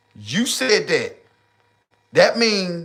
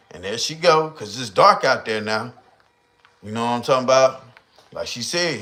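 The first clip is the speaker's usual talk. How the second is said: normal talking voice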